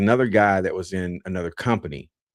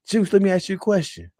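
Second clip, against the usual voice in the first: high, teasing sergeant voice; mock drill sergeant; 11 semitones higher than usual. Drill sergeant questioning a recruit, high and teasing. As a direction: lean in like setting a trap. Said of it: "He said".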